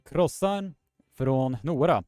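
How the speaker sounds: krossan från Nora with Swedish r and long o in från; Swedish pronunciation. Shown as a demonstration. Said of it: "you are saying".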